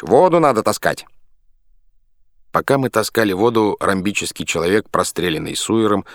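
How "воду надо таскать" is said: loud, high shout. A narrator's reading. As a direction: bark out the order. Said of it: "кричал Пахомыч".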